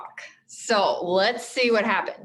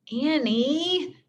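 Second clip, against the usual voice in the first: warning; 6 semitones higher than usual. A narrator's reading. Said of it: "warned Jack".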